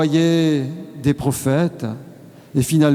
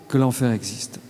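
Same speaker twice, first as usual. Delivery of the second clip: hushed solemn repetition; 5 semitones lower, 6 dB quieter than usual. Real, not acted. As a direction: lower and softer, gravely. Solemn repetition, lower and softer.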